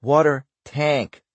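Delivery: put-on Cordero echoing "water tank" slowly in Boston accent; drawn-out Boston "water tank". A narrator's reading. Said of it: "Excuse me".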